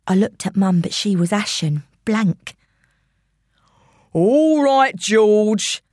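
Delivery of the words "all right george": raised, impatient London villain; intruder's voice. Acted. Intruder giving up waiting for George, impatient villain. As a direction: call out as a fed-up villain. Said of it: "came the first voice".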